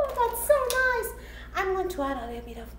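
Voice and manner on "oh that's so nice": high gasp then praise; delighted gasp. Real, not acted. Seeing the sprinkles.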